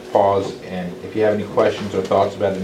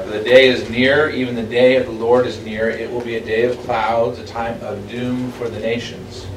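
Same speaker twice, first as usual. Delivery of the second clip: raised, louder solemn reading voice; scripture reading; 2 semitones higher, 4 dB louder than usual. Acted. Teacher reading Isaiah aloud to the Bible study, solemn. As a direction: read it out as holy text.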